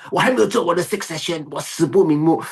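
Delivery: high, hammed-up panicked protest; loud, high-pitched play-acted dismay; mock distress. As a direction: wail it like a sleeper jolted awake with duties undone. Acting someone waking, unfinished, from death.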